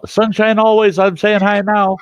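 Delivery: high, loud shout-out; calling out a greeting. Real, not acted. Breaks off the story to greet Sunshine, calling out. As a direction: project a high-pitched hello over the room.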